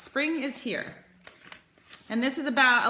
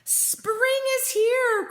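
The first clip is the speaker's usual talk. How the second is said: high, slow, louder joyful call; excited cry; 12 semitones higher, 3 dB louder, much slower than usual. Acted. Mole telling the world that spring has come, excited and high. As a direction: announce it like the best news ever. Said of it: "cried Mole".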